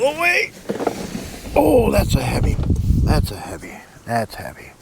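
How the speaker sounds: strained, grunting as he lifts; straining under weight. Real, not acted. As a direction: push the words out while hauling something heavy. Lifting heavy stuff out.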